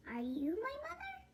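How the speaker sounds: high, soft little chick asking; baby bird. Acted. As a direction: ask the hen hopefully, small voice. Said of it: "he said to the hen".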